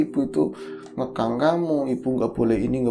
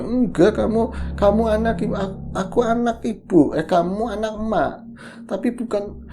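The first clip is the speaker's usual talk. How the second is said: choked-up speech; 8 semitones higher than usual. Retold or imitated. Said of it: "ngomongnya susah banget".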